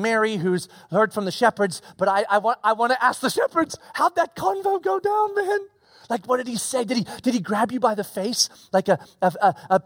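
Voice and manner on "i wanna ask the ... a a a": ordinary talk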